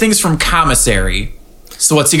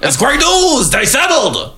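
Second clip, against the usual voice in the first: Sandy impression; 8 semitones higher, slower than usual. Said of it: "he's like".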